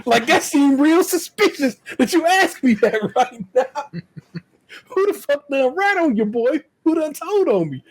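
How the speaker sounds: high-pitched, half-laughing disbelief; laughing mock suspicion. Son suspecting someone told mama his surprise, laughing mock outrage.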